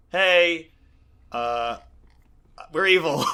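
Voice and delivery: hammed-up villain confession, tongue in cheek; mock villains. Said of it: "and they're like".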